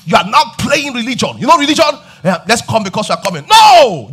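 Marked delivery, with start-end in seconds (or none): 3.5-4.1 loud sudden No from the pulpit; emphatic no. Real, not acted. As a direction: shout the word down hard. Preacher rejecting empty religion.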